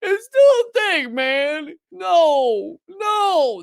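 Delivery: mock-cry like a clinging fan who can't let go; mock whiny newspaper. Mocking the New York Times clinging to Russiagate, fake-crying whine. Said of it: "continue to be like".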